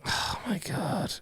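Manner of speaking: breathless whispered oh my God; whispered disbelief. Real, not acted. Disbelief at mom story.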